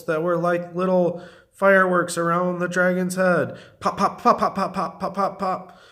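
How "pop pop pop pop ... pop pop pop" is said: quick string of high popping sounds; firework pops. A narrator's reading. Narrator voicing fireworks round dragon's head, rapid popping. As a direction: imitate little fireworks with crisp pops.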